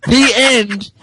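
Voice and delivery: plain reading voice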